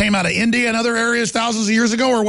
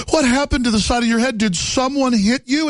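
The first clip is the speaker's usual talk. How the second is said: higher-pitched imitation of a shocked woman; exaggerated concern, pitched up; mock alarm; 5 semitones higher than usual. Imitating a stranger questioning a boy about his head, mock alarm.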